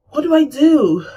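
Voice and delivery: high, loud helpless plea; retold panic. Speaker faces telling friend's mother, mild panic. Retelling her panic at the news.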